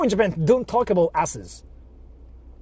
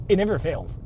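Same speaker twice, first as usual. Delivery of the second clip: ordinary delivery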